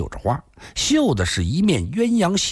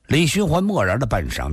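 ordinary delivery, nothing marked

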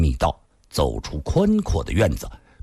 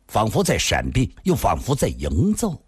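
plain reading voice

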